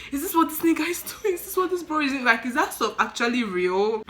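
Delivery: high, loud incredulous questioning; alarmed disbelief. Retold or imitated. Wondering whether the attendant talks to corpses, alarmed. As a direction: panicky inner voice, pitched well up. Retold alarmed thoughts at the door.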